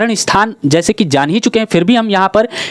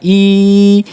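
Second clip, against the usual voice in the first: long drawn mock crying ई, louder; imitated child's wail; 4 dB louder than usual. Teacher imitating a crying child's long ई. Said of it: "ई का उच्चारण बार बार मतलब थोड़ा दीर्घ".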